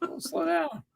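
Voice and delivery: high, laughing mock reply; playful high mock. Driver answers the farting joke in a high mock voice. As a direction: toss it off in a high voice. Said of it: "And I was like".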